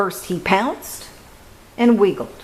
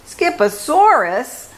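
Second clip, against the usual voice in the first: high, startled mother's voice; a mom spotting trouble, pitched way up; hammed-up surprised mother asking; surprised mama; 9 semitones higher than usual. A narrator's reading. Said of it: "declared Mama Junebug Jones".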